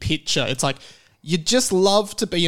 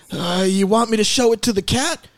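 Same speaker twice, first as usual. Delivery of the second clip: patronizing, slightly raised voice; Kirk Van Houten; 7 semitones higher, 3 dB louder than usual. Retold or imitated. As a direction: talk down as if to a fool. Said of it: "I'm gonna butcher the voice".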